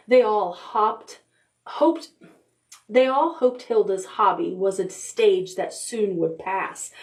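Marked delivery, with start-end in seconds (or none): none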